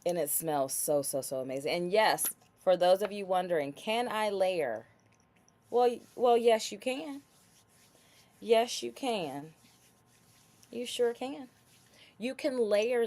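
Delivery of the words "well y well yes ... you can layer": higher and slow, teasing yes; drawn-out playful assurance; emphatic yes. Real, not acted. Telling viewers they can layer, with a slow teasing yes.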